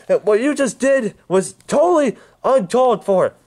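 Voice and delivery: furious. Acted. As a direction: lay into him in rage.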